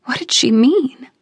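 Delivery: ordinary delivery, nothing marked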